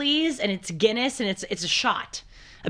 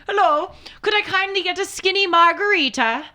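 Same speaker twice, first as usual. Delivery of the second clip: high, louder mock Irish order with a rising end; hammed-up Irish pub order; Irish accent; 11 semitones higher, 7 dB louder than usual. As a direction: ask politely in a put-on Irish accent. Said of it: "I guess now I'm in an Irish accent".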